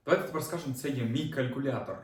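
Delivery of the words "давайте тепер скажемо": usual speaking voice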